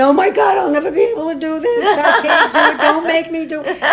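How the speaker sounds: high, fast, hammed-up panic; mock panic. Actress imitating nervous castmates before opening night, mock panic. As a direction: play the panicked cast member, half joking. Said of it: "it happens with a lot of angst".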